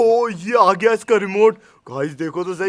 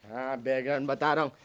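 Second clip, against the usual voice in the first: lower, softer mocking echo of his brother; mocking mimic; 7 semitones lower, 10 dB quieter than usual. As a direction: parrot his words back to tease him. Younger brother mocks older brother.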